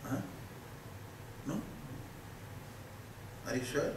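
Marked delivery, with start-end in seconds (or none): none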